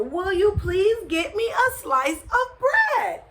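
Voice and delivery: high-pitched, insistent plea; exasperated mother. A narrator's reading. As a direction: repeat it at the end of your patience. Mother repeats request, exasperated.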